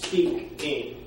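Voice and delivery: Speak, aim split into two beats; syllables split apart. Shown as a demonstration. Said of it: "take the word speaking".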